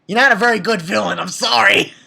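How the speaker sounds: quick, loud scolding of a villain; loud dismissal. Real, not acted. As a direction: blurt it loudly, unapologetic. Tells off a villain directly.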